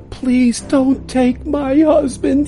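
high, pleading voice; pleading wife. Acted. Wife begging the sheriff not to take her husband. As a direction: beg the sheriff to spare him.

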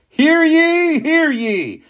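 high, loud royal proclamation call; loud herald cry. Acted. Royal knight announcing to the town, loud and high. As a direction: ring it out over the crowd. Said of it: "he cried loudly".

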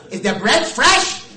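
loud, high, slow mock old lady; hammed-up fussy old woman. Old lady at the bakery after Pesach, loud mock voice. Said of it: "an old lady walked in and said".

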